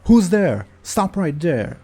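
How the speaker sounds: startled high-pitched shout at a stranger; alarmed unfamiliar voice. Acted. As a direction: challenge an intruder, high and loud. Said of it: "you hear an unfamiliar voice say".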